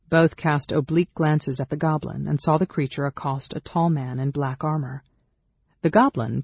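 ordinary delivery, nothing marked

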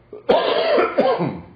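dry harsh cough; a short rough cough; coughing on dust. Real, not acted. Lecturer coughs mid-lecture.

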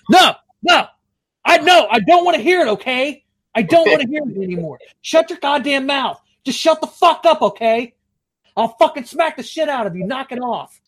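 high-pitched mock tirade; hammed-up yelling. Prankster acting crazy at the call's end, hammed-up angry yelling. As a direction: suddenly blow up at the caller, play-acting fury. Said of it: "I had to fucking yell".

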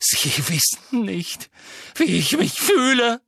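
high, loud roar building up. A narrator's reading. Harry roars at Dumbledore he doesn't understand. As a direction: hurl it at him. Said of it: "brüllte Harry".

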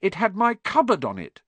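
high, indignant retort; angry. A narrator's reading. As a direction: argue back heatedly. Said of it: "said Harry angrily".

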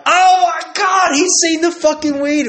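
very high, loud squeal of alarm; hammed-up freak-out in a squeaky voice; mock panicked shriek. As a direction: wail it way up high, stretched out. Friends panicking that dad saw the weed.

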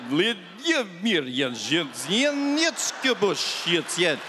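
choppy fake Russian, like tape in reverse; mock Russian, reversed. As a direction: stutter it like a paused reversed tape. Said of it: "English played in reverse ... pressing pause".

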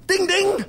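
bell ding imitation. Mimicking call bell.

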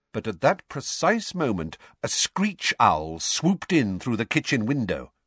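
ordinary delivery

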